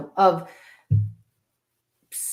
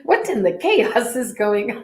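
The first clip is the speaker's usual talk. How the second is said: higher, amused mock exclamation; imitating herself exclaiming; 5 semitones higher, 4 dB louder than usual. She pictures herself exclaiming in public, amused. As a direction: hear yourself blurting it out in a line. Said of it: "I can just hear myself saying that".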